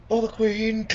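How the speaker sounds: high, loud mock yell; imitated battle cry. Player corrects the spoiler by quoting the TV hero, mock yell. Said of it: "He yells for the".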